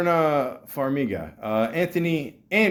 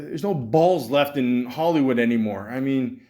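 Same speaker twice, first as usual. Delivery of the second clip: ordinary talk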